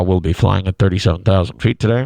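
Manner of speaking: low, droning bored pilot; lightly voiced bored pilot. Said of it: "Like the bored".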